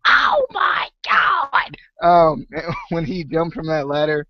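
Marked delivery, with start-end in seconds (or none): 0.1-1.8 very high, slow, stretched Oh my God; replayed excited exclaim. Retelling her reaction to Grado's jump, high stretched excited exclaim. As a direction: shriek it out in delight. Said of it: "Grado made me say".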